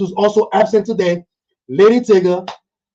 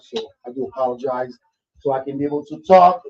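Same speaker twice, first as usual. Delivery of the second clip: ordinary talk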